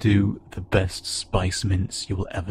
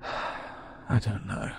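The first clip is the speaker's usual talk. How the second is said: sighing, whispered uncertainty; 10 dB quieter, much slower than usual. Acted. Sighs, unsure about what listener is.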